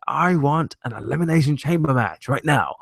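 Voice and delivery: a hint of Eric Bischoff making a match. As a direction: play a GM barking an order. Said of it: "the return of Eric Bischoff saying".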